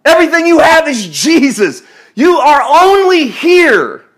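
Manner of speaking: loud, high, driving sermon climax; full-force preaching. Acted. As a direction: hammer each line home to the congregation.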